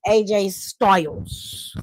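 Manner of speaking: drawn-out ring announcer. Acted. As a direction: stretch the name like a ring announcer, low and slow. Dramatic wrestler name announcement.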